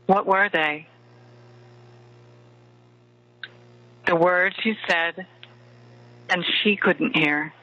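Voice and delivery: right in your ear. Acted. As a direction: murmur close like a lover at bedtime. Leads a bedtime love meditation.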